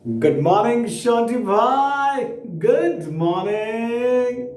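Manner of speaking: long drawn-out, singsong morning greeting; gushing greeting. Acted. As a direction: stretch the words out, rising high to flatter. Buttering up the landlord. (चापलूसी भरा लंबा, सुरीला अभिवादन)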